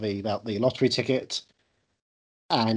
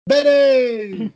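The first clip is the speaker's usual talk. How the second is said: loud, high, drawn-out shout of the name; playful full-voiced holler; exuberant call; 12 semitones higher, 10 dB louder than usual. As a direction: belt the name out like a fanfare. Calls out the best friend's name.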